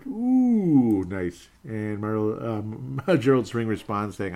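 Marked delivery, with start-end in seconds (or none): 0.1-1.0 high, loud, long falling "ooh"; loud swooping reaction sound; impressed ooh. Real, not acted. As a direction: let out a drawn-out hoot at a zinger. Wincing reaction to foot-shooting joke.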